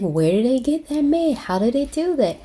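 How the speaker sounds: excited mock questioning; curious onlookers. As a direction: mimic people wondering aloud, pitched well up.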